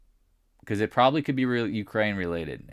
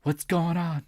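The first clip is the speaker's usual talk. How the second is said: high-pitched imitation of panicked texters; mock freaking out; 9 semitones higher, slower than usual. Traders text him that the world's ending, mock panic. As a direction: pitch way up, mimic people freaking out. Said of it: "People freaking out".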